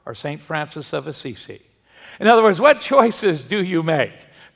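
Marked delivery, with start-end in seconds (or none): 0.0-1.6 soft, gentle question from above; gentle inquisitive voice. Acted. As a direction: ask it gently and curiously. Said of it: "a gentle but inquisitive voice said".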